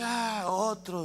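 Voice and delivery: slow, fed-up sigh of a sulking devil; weary devil. Retold or imitated. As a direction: drag it out like a bored devil. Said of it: "el diablo lo ve y dice".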